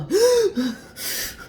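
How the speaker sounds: a sharp, high pretend gasp; imitated gasp. Imagining herself in the box, mock gasp for air.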